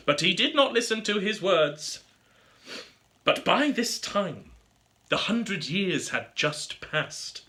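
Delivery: plain reading voice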